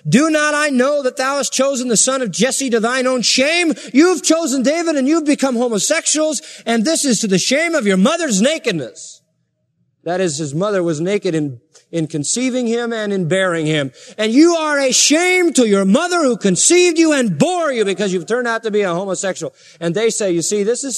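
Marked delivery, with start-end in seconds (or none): 0.1-9.2 angry king. Retold or imitated. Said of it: "And so he got mad at Jonathan". 14.2-19.5 loud, high accusing outburst that sinks at the end; angry king. Retold or imitated. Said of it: "And so he got mad at Jonathan".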